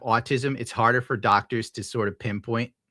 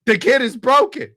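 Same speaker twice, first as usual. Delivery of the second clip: higher, louder mock alarmed adult; mock worried grown-ups; 10 semitones higher, 7 dB louder than usual. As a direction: play the alarmed parent, lightly hammed. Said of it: "they're like".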